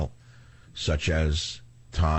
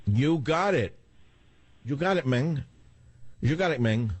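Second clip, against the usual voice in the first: imitated Spanish accent; 6 semitones higher than usual. Shown as a demonstration. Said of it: "I like to imitate accents".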